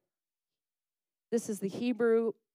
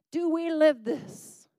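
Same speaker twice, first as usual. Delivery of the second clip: higher, louder, slow challenge to the room; challenging question; 8 semitones higher, 8 dB louder, slower than usual. Real, not acted. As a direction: press the congregation, slow and raised. Preacher challenges congregation.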